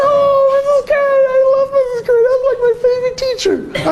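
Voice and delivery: put-on gushing girly voice up high; imitating the student's delighted squeal; high excited woman.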